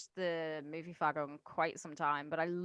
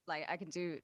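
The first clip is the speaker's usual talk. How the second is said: usual speaking voice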